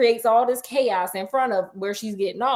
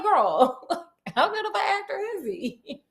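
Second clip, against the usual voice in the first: high and giggly; mock the fake death, laughing; laughing aside; 9 semitones higher, 4 dB quieter than usual. Telling Irene about the actor, laughing. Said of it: "I'm like".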